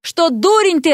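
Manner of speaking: high-pitched, mildly yelled jibe; indignant retort. A narrator's reading. Vasya answers him from the hat, indignant. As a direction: snap back at your captor.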